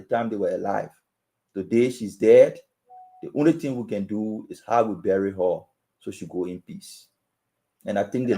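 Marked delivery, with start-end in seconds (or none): none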